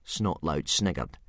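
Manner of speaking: plain reading voice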